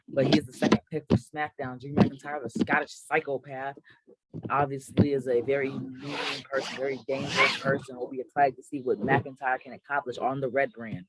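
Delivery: ordinary talk, nothing marked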